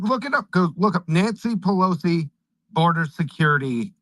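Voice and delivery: usual speaking voice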